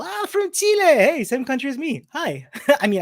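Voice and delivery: normal talking voice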